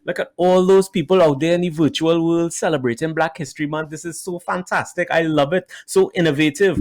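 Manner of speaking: put-on Trinidadian accent way up high, bubbly and delighted. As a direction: gush like an excited Trini wife, Trinidadian accent. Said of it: "she has the accent".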